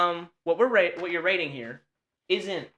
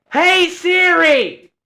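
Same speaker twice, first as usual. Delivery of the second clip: slow, high, loud shout; long-drawn high yell to the phone; frustrated calling; 13 semitones higher, 10 dB louder, much slower than usual. Real, not acted. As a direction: drag the call out at full voice. Frustrated call, escalating to shout.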